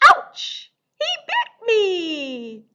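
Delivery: high slow mock whimper; hammed-up bitten whine; mock ouch. Bitten by mouse in song.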